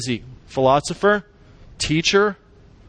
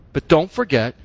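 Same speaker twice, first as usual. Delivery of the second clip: ordinary talk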